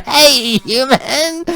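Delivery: high, strange-voiced call out to the dark; calling for the creature outside, a mock call. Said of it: "turning around, going".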